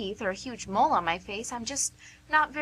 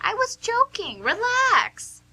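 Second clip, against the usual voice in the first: laughing reassurance; 9 semitones higher, 5 dB louder than usual. Acted. Teasing reassurance after fake hurt.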